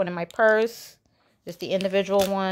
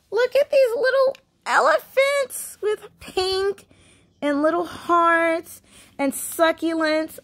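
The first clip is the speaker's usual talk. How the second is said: much higher and slow, cooing over something cute; gushing; 10 semitones higher, slower than usual. Real, not acted. Vlogger showing her favorite elephant planters, high cooing gush. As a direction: coo like it's the cutest thing ever.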